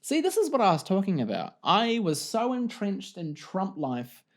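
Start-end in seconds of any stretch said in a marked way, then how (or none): none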